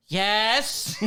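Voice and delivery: high, loud exclamation; exasperated yes. Retold or imitated. Fed-up visitor answering track staff, exasperated. Exasperated punchline in retold exchange.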